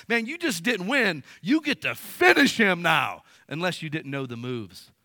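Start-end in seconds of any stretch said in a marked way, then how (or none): 0.1-3.2 hyped gamer. Retold or imitated. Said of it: "I'm like".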